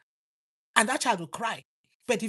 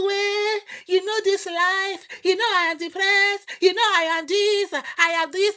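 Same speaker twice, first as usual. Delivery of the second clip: higher, louder whining excuse in a mock voice; whiny mock excuse; 8 semitones higher, 5 dB louder than usual. Preacher mimics a mother excusing herself, loud mock whine. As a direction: whine it like a pouting excuse-maker.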